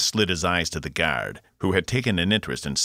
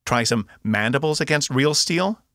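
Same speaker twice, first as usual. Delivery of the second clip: taunting repeat; 9 semitones higher, 4 dB louder than usual. A narrator's reading. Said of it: "Box repeated".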